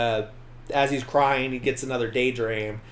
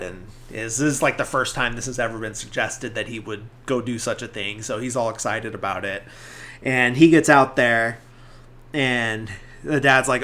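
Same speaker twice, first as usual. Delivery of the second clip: ordinary delivery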